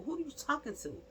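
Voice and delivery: high offended challenge; indignant. Pretend. Grandmother challenging the aunt, high offended tone. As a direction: lift it high like you can't believe it.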